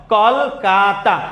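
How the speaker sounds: কলকাতা slowly as kol, ka, ta; three syllables. Shown as a demonstration. Teacher recaps the count for students, three syllables. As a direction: stretch কলকাতা into three separate beats. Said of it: "মোট তিনটা".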